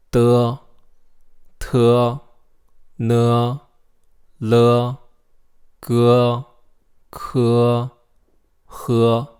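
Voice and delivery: "de" tot "he" luid en duidelijk; Pinyin e syllables. Shown as a demonstration. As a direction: lettergrepen luid voorzeggen met doffe e. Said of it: "let's say these pinyin words".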